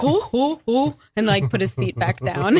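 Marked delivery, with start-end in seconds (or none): 0.0-1.0 a hint of a caught-out son; lightly voiced flustered oh; mock startled son. Imitating her son caught with feet up, mildly flustered. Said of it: "then he'd be like".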